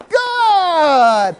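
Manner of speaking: long high excited "Good"; happy, excited. Shown as a demonstration. Coach showing the crowd happy praise, excited cheer. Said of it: "Happy, excited now".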